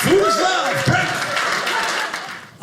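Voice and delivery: high, loud team chant; huddle-break shout. Acted. Dad breaking the buffet huddle with his family, shouted.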